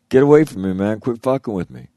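mock yell. Retold telling off a pranking buddy.

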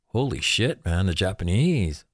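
ordinary delivery

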